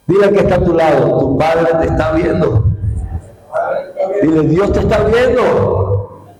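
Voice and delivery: rising, shouted exhortation to the congregation, with padre stressed over and over; an impassioned call that God the Father is watching; fiery preaching. Acted. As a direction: preach at full force, driving each phrase home. Preacher builds to full-force exhortation.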